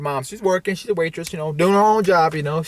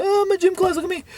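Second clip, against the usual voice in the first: high-pitched mock child's voice; little kid; 13 semitones higher, faster than usual. Little kid showing off in gym class, high childish voice. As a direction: squeak it like an excited kid. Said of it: "like a little kid".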